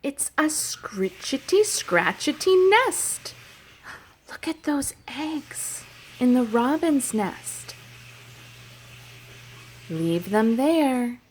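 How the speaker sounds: rhythmic, sing-song story chant; reading to children. Acted. Teacher reading a nature rhyme to children, sing-song. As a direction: read it like a picture book for little kids.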